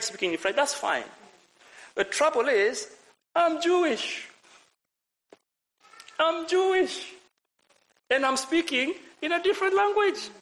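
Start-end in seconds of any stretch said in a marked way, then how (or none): 1.9-10.3 very high-pitched disbelief; mock-astonished, high voice; acted astonishment. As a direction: pitch it way up, amazed at yourself.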